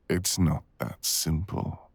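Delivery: usual speaking voice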